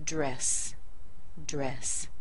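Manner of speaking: "dress" said alone, falling, like the clothing; demonstrated syllable. Shown as a demonstration. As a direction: model "dress" separately with the vowel of egg. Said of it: "same pronunciation as the article of clothing".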